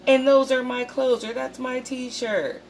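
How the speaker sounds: high, rising indignation; imitating the viewer's shock at seeing their clothes, high and indignant; outraged disbelief. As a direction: call it out in disbelief. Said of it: "And you're like".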